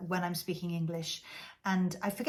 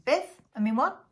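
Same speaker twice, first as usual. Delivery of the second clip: high, louder questioning blurt; acted puzzled query; 5 semitones higher, 5 dB louder than usual. Speaker slipping into Welsh in an English conversation, high query. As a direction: blurt the question, then correct yourself.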